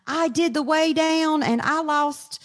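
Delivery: mock a churchgoer bragging about weight loss; church testimony voice. Mocking a churchgoer testifying on stage, high and gushing. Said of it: "people on the stage at church going".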